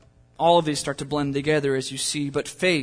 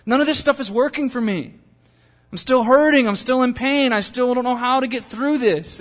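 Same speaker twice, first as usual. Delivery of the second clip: anguished plea; 7 semitones higher, 5 dB louder than usual. Retold or imitated. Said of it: "you're just like".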